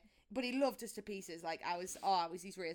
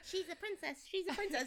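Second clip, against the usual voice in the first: mock-sweet cooing; 9 semitones higher than usual. Cooing over sister beside feral self.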